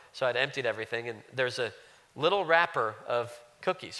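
ordinary talk

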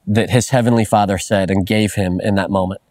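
usual speaking voice

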